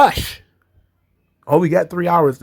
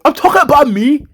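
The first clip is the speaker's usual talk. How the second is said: high, loud Nikki squeal; imitating Nikki; 9 semitones higher, 4 dB louder than usual. Host imitating Nikki boasting to Rhea, high and loud. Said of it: "she's like".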